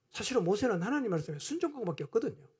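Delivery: ordinary delivery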